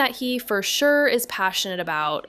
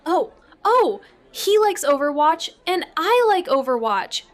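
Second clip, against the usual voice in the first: high and louder, eager burst; excited; 7 semitones higher, 4 dB louder than usual. Acted. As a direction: blurt it out thrilled. Niece excitedly shares common interest.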